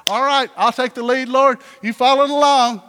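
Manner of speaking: loud, high, bossy take-charge voice; mock-bossy, loud and insistent; upset and frustrated. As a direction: order God to fall in behind you. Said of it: "upset and frustrated".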